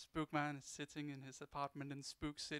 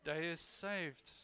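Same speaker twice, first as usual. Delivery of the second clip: slow narrator; 2 semitones higher, much slower than usual. Acted. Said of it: "I'm gonna dub it for you".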